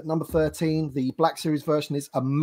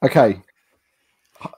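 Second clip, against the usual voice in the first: usual speaking voice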